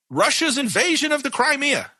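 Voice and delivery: mock the war-talk headline with scorn; high sarcastic echo of the media line; mocking media phrase. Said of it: "Them's fighting words".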